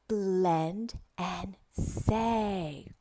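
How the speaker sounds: very drawn-out words; slow, deliberate classroom prompt; slow teacher modelling. Shown as a demonstration. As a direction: stretch each word for a young learner. Models slide title for student.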